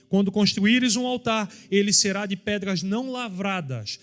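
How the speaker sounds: fervent pulpit delivery, pitch lifted; preaching at full force. Acted. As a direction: preach it out high and strong to the church. Preaching to the congregation.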